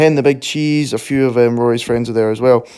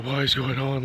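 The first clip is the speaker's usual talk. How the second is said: much softer, dazed; stunned quiet; 10 dB quieter than usual. Retold or imitated. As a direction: hush it, overwhelmed. Stunned, overwhelmed moment.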